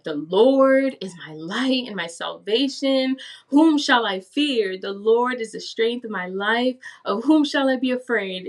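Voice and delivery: retold fervent verse reading; Psalm recitation. Recites the Psalm she read out.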